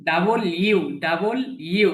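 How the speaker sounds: ডাবল ইউ split clearly into two words, double and U; correct W. Shown as a demonstration. Teacher correcting the student's letter W, carefully. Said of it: "এই যে ইউ যে পড়লাম".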